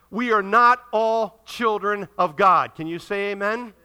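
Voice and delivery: normal talking voice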